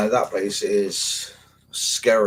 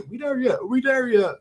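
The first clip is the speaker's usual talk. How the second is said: put-on high, nagging kid voice, much higher than his own; whiny twelve-year-old. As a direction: pester like a bored child on a long walk. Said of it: "I'm turning to a twelve-year-old".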